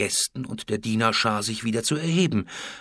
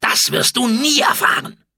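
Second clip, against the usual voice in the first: furious defiance; 11 semitones higher, 8 dB louder than usual. A narrator's reading. Food taster refuses and threatens the queen, furious defiance. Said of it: "Der Vorkoster ballte die Fäuste".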